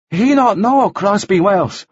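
slow, high halting interpreter; Arsuk, translating. A narrator's reading. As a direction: answer in a high, drawn-out character voice.

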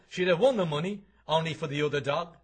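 loud jocular. Acted. Joe joking in pub.

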